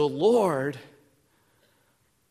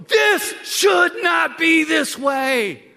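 slow, high, loud shout; preached shout; 9 semitones higher, 5 dB louder, much slower than usual. Pretend. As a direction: cry it out slowly from the gut. Burdens cry out, preached.

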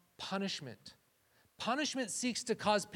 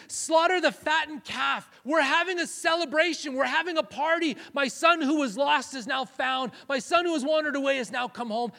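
jubilant announcement; 8 semitones higher, 10 dB louder than usual. Retold or imitated. Father proclaiming the party for his son, loud and jubilant. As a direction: proclaim the feast with overflowing joy.

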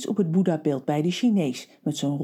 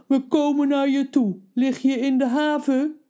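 a hint of a calm giant on the phone; lightly voiced giant reassuring a friend; 8 semitones higher, 3 dB louder than usual. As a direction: reassure her, a little higher.

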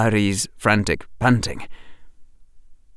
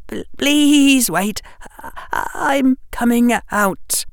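put-on high, slow, wavering plea from a boy near tears; a scared child begging them to wait; frightened young boy; 13 semitones higher, slower than usual. A narrator's reading. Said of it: "high and thin and wavering".

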